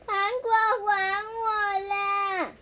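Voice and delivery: loud, high, slow wail; crying demand. Acted. Child crying at Suneo to return the candy. Kid quarrelling over stolen candy.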